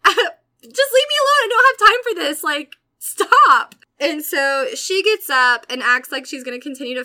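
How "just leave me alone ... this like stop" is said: much higher, loud retold yell. Teen yelling at the bully on the floor, loud and high. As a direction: shout her off at full force. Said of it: "continuously yelling".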